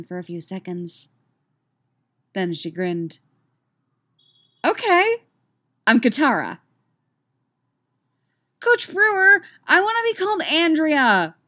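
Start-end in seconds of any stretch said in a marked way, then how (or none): 4.6-6.6 high, loud, happy announcement; delighted girl. A narrator's reading. Girl announces her new name to the coach, delighted. 8.6-11.4 put-on high, loud girlish call; excited shout. A narrator's reading. Girl with hand up begs coach for a new name, shouting. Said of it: "shouted Priscilla".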